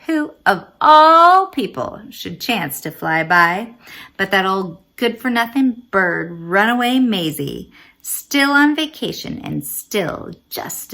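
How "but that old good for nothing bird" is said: ordinary delivery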